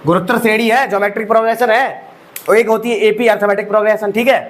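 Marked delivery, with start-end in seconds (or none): none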